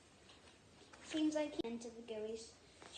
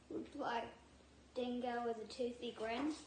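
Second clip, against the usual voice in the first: ordinary delivery, nothing marked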